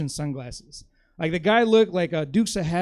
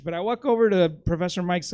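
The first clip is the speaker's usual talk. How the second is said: normal talking voice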